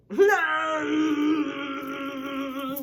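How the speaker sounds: long high loud wail; drawn-out hammed-up cry, loud; mock wail.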